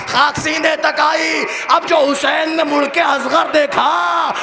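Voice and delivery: soaring, slowed wailing climax of a majlis reciter; پرجوش مرثیہ خوانی کی چیخ; full-force lament preaching. Acted. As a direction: drive the grief up to a shout, drawing the words out.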